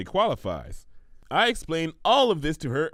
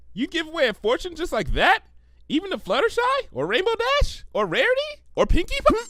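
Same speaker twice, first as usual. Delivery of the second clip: much higher, rising string; eager rapid questions; 3 dB louder, faster than usual. Acted. Pinkie peppers Twilight with questions, eager. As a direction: build excitement up.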